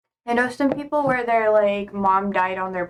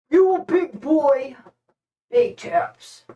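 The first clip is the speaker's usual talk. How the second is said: pet talk; 4 semitones higher, slower than usual. Real, not acted. Talking to her pet.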